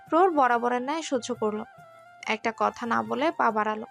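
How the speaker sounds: ordinary talk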